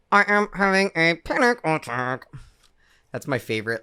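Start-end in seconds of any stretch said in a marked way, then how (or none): 0.1-2.3 stiff robotic monotone. Pretend. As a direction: fake robot voice, deadpan. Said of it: "going into fake robot voice".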